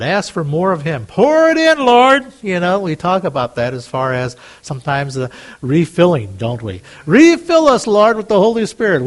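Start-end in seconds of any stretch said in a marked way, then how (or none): 1.1-2.3 pleading, much higher and louder; fervent cry to God. Acted. Crying out in prayer. 7.1-9.0 pleading, much higher and louder; fervent cry to God. Acted. Crying out in prayer.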